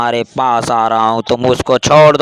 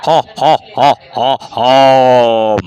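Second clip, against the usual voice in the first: witch cackle; slower than usual. Acted.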